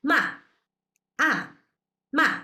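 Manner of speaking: ma falling sharply from high; short strong falling ma; fourth tone. Shown as a demonstration. Teacher demonstrating the sharp falling fourth tone. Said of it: "The fourth tone".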